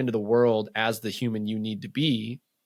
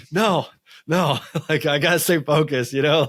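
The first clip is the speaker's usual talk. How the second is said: louder, higher, laughing no; shaking off the rest with a laugh; laughing refusal; 4 semitones higher, 5 dB louder than usual. Retold or imitated. Husband refusing his wife's advice to rest, laughing and louder. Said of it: "And I'm like".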